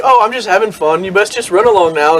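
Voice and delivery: high, playful mock-innocent voice; sly leprechaun brushing them off; cheeky leprechaun. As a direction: shoo them off with a grin. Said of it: "and they're like".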